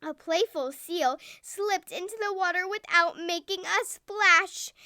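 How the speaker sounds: ordinary delivery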